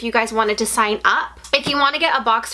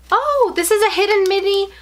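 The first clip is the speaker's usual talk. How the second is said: excited squeal; 9 semitones higher than usual. Real, not acted. Finding a mystery item.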